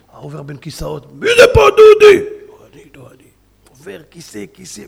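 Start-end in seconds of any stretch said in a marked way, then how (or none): 1.1-2.2 thug shouting. Retold or imitated. Thug searching bus again. 2.5-3.3 scared whisper. Retold or imitated. Scared passenger denies being Dudi.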